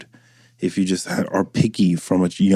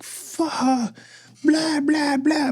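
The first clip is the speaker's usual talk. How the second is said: put-on much higher, squeaky little-kid babble; whiny toddler; 3 dB quieter, much slower than usual. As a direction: whine like a spoiled toddler. Son acting up when mom's around.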